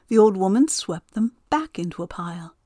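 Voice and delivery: ordinary delivery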